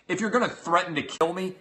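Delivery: normal talking voice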